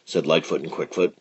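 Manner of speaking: ordinary delivery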